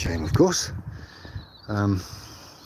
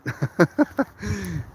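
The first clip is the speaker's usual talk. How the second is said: happy laugh; 9 semitones higher than usual. Real, not acted. Happy laugh at own smile.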